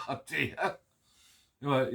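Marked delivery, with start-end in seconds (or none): 0.1-0.8 chuckling with embarrassment; laughing oh dear. Real, not acted. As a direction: laugh it out, a bit sheepish. Recognised in shop, embarrassed.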